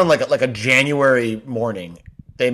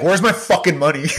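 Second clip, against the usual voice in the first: mock-furious demand from an annoyed commuter; angry yell; 5 semitones higher than usual. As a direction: snap it at him like he stole your cash. Angry commuter yelling at him.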